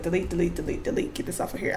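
soft, breathless repeated mutter; frantic under the breath; muttered panic. Retold or imitated. Cohost retelling deleting the photo, softly muttering. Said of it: "I was like".

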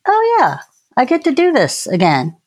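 mock cheerful. Cheerful mock morning self.

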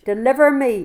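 loud, high cry of "Deliver me"; pleading aloud to God in the sermon; fervent plea. Acted. Quoting the psalm fervently.

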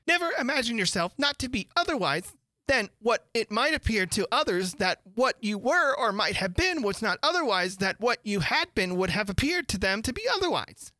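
put-on moralizing old noblewoman, sing-song; fussy Duchess voice. A narrator's reading. Duchess voiced in read story.

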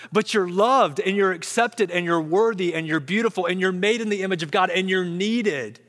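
ordinary delivery

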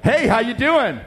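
high, perky greeting in imitation. Young businessman greeting strangers at a restaurant, acted perky. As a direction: imitate an over-chipper stranger. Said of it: "just all perky-like".

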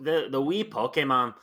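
hammed-up Scottish lilt; Scottish accent. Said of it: "glad that you stopped your Scottish accent there".

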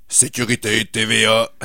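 lightly voiced guard, flat and authoritative; a hint of a stern intercom guard; monotone authoritative guard. Host retells the intercom guard, lightly acted, monotone and authoritative. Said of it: "une voix très, très monotone, très, euh, autoritaire".

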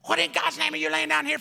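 fast, high-pitched mock tirade; chief cursing. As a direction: rattle off an outraged scolding. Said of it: "began to let some explicatives out".

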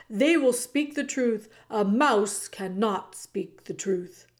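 loud, proud royal declaration; pompous king. Acted. The king loudly dismisses the mouse, proudly. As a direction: announce it grandly.

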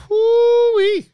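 high, loud gleeful whoop; excited whoop. Real, not acted. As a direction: let out a joyful holler. Hyping the Airs signings.

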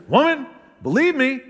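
high, loud, condescending demand; mansplaining tone. Shown as a demonstration. Preacher mimics a wrong tone for Jesus, loud condescending mansplaining. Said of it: "Do you think he said it like this".

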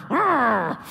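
comic effortful grunt; prayer grunt. Retold or imitated. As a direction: strain like you're forcing a prayer through. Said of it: "I'm gonna grunt in prayer".

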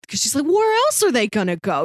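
ordinary delivery

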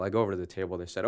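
ordinary delivery

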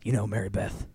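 lightly voiced world-weary outlaw; gloomy Arthur. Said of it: "and Arthur's like".

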